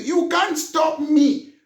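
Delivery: you can't stop me, slowed and shouted high; defiant shout. Real, not acted. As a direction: drag it out, daring them to try. Defiant rallying outburst.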